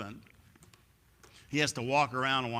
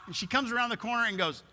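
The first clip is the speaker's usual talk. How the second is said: usual speaking voice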